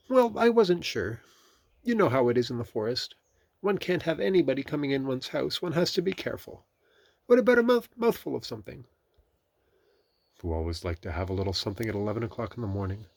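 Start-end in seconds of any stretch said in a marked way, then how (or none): 0.1-8.9 high storybook rabbit voice; careful Rabbit. A narrator's reading. Rabbit explaining caution to his guest, high voice.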